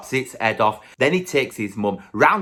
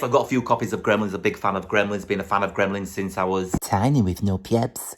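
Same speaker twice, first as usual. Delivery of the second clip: ordinary talk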